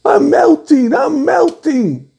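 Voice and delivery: high, wailing witch cry. Retold or imitated. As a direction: wail it like the melting witch. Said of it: "the witch goes".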